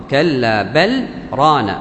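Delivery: slow كلا بل ران, L fully sounded, pause before ران; clear iẓhār with sakt. Shown as a demonstration. Teacher showing Hafs's reading, بل clearly separated from ران. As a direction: stop briefly after بل, no merging into ران. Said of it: "يقول بالإظهار".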